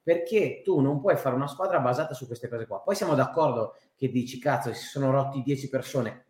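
ordinary talk

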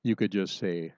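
usual speaking voice

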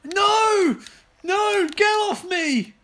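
high, loud panicked screams; panicking gamer. Real, not acted. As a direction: scream as zombies grab you. Attacked by zombies.

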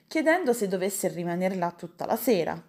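ordinary delivery, nothing marked

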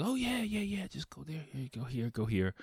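eager high helpful voice dropping soft. Retold or imitated. Friendly stranger gives directions to the bar, eager and high. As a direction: point the way, cheerful and quick. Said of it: "a super friendly [name] is like".

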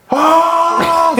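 high, long-held "aah"; mock yell. Driver reenacting his yelling at the woman, mock scream. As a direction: act out a wordless yell. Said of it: "så råber jeg stadig".

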